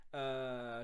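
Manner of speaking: usual speaking voice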